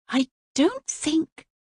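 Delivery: put-on high curious girl's question; young princess. A narrator's reading. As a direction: lift it up like a wide-eyed girl. Voices the princess.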